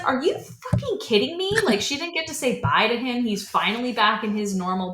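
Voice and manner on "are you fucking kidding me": high, fast, incredulous retort; heated outburst. Retold or imitated. Kat snapping at Albert after he destroys Jamie, heated. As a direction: fire it back at him in disbelief.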